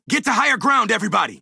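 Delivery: high-pitched battle order; urgent warning call. Acted. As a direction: warn the whole team, urgently.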